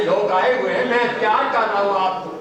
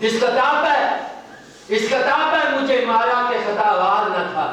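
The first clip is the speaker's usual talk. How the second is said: verse declaimed in a raised, sustained pitch; poetry recital; 5 semitones higher than usual. Acted. Poet reciting a lament to the gathering, declaimed high. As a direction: recite each line high and measured, repeating for effect.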